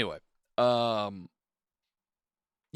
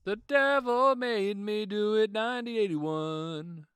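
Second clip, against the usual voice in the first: movie trailer voice; 7 semitones higher, slower than usual. Acted. Announcing title like a trailer.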